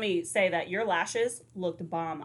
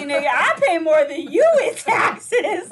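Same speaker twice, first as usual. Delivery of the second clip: laughing mock brag; 7 semitones higher, 9 dB louder than usual. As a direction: shout it like a smug rich guy between laughs. Mock boasting rant, laughing shout.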